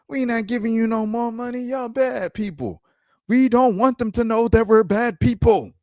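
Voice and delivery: high, squeaky mock whine of the foundation; whiny, pleading voice for a mocked organisation; high mock plea to stop the records requests; mocking the Police Foundation. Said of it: "So they're like".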